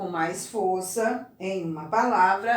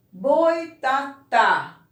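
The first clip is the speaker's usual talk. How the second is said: boitatá slowly, with a louder, stretched final TÁ; stress on last syllable; 4 semitones higher, 6 dB louder, much slower than usual. Shown as a demonstration. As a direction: land hard on the TÁ of boitatá. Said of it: "Qual é a sílaba pronunciada com mais força?".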